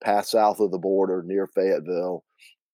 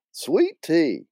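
lightly voiced Southern ladies; higher, swooping reply; a hint of two Southern aunts; Aunt Hallie and Linda; 7 semitones higher than usual. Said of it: "do my best Aunt Hallie and Linda".

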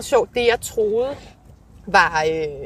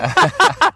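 short bright laugh; 10 semitones higher, 5 dB louder than usual. Real, not acted. Laughing at own story.

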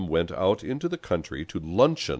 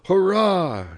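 high, loud hurrah; raised, high-pitched cheer; shouted; 13 semitones higher, 6 dB louder than usual. A narrator's reading. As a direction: cheer like a band of followers. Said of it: "shouted Pepone's satellites".

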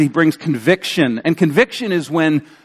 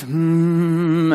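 imitated synth pads. Preacher mimicking pads under a sermon's close, sustained hum. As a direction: hum a held note as background music. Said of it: "Imagine the pads are playing underneath".

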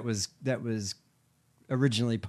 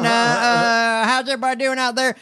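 high hammed-up trucker; loud high mock trucker on CB; 13 semitones higher, 7 dB louder than usual. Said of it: "it was like".

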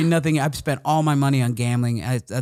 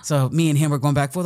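ordinary talk, nothing marked

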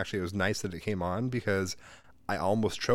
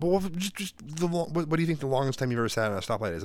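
put-on eager kid voice; 6 semitones higher than usual. Son pestering, voiced in story.

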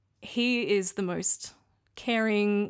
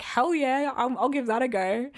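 imitating an eager partner, higher pitch; retold keen enthusiasm, pitched up; 5 semitones higher, 3 dB louder than usual. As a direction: jump at the chance in a put-on voice. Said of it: "Slade's eyes lit up".

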